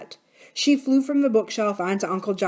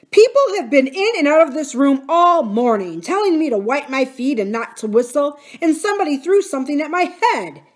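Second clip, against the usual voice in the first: loud, high-pitched outraged rant; fuming; 7 semitones higher, 7 dB louder than usual. A narrator's reading. Uncle Jocelyn raging to his wife about interruptions, fuming. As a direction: pour out the complaint in a rage. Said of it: "he fumed".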